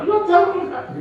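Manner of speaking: high, loud tearful wail; acted weepy outburst; imitated crying. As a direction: wail it out as if sobbing. Acting the sibling crying.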